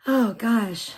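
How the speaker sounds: normal talking voice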